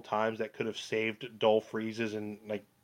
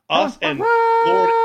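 loud herald's hear ye call; town crier; 7 dB louder, much slower than usual. Pretend. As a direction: proclaim it like a medieval crier. Grand announcer proclamation.

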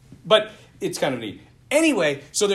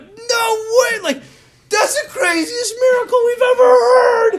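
much higher, much louder, yelling in disbelief; astonished cry of excitement; excited shout; 12 dB louder than usual. Retold or imitated. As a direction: shriek it like you can't believe it. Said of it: "freaking out".

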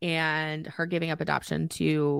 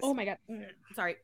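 coughing, choked up on air; choking on air; 10 dB quieter, much faster than usual. Real, not acted. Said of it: "choking on my air".

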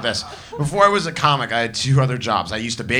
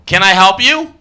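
cashier greeting; 6 semitones higher, 9 dB louder than usual. Retold or imitated. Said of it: "supposed to do when someone walks".

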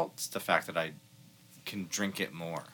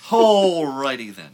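slow, louder goofy Ace Ventura voice; Jim Carrey quote; 3 semitones higher, 9 dB louder, much slower than usual. Acted. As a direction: stretch it out goofy. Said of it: "Dumb and Dumber".

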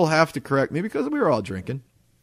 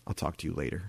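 low, soft, curt brush-off; understated pretend anger; cold dismissal; 9 semitones lower, 11 dB quieter, faster than usual. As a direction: shut him down quietly. Wife feigning anger, retold.

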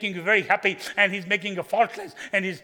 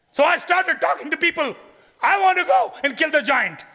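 higher and louder eager announcement; excited boast; 10 semitones higher, 9 dB louder than usual. Acted. Young David tells the people, loud and eager. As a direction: tell everyone you'll kill the giant.

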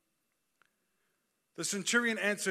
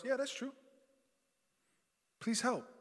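higher-pitched, humble plea; a hint of begging, pitched up; lightly voiced pleading woman; 8 semitones higher than usual.

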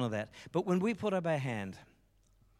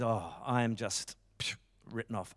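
dejected man; 6 semitones lower, 5 dB quieter than usual. Retold or imitated. Broken man writes himself off.

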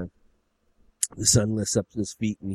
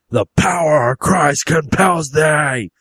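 loud, slow, high exorcist's cry; drawn-out hillbilly exorcism chant; praying father; 5 semitones higher, 7 dB louder, slower than usual. Acted. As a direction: preach it out to drive devils away. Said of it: "he's, like, praying for him".

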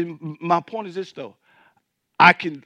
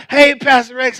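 retold yell; 7 semitones higher, 8 dB louder than usual. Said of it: "there's somebody yelling".

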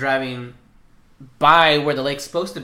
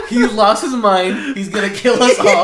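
laughing; 7 semitones higher, 7 dB louder, slower than usual. Retold or imitated. Laughing retelling of scared car ride.

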